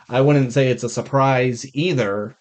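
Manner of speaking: normal talking voice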